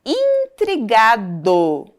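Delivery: INTRIGADO slow, with DO set out clearly; final syllable emphasized. Shown as a demonstration. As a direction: stretch intrigado towards its final DO. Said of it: "Olha o som final".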